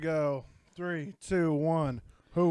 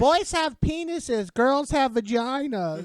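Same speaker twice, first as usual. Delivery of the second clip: put-on little kid voice; 8 semitones higher, slower than usual. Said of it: "that little kid's like".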